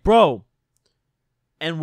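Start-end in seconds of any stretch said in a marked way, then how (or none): 0.0-0.4 mock loud bro. Dan pleading, retold.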